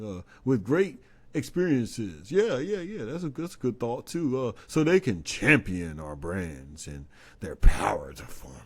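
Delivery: hammed-up corporate spokesperson with swooping pitch; halting corporate pitch, eagerly agreeing with prompts; mock spokesperson. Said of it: "this is how they sound in my head".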